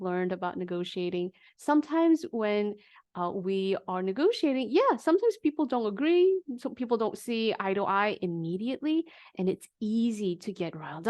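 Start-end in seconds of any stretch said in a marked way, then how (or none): none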